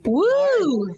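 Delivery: excited cheer. Real, not acted. Cheering a friend's lead.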